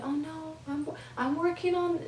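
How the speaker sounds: high, put-on whiny excuse voice; mocking excuse. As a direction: mimic someone making excuses.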